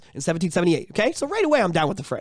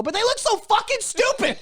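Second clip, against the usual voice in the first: mock indignant retort; 14 semitones higher than usual. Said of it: "And I go".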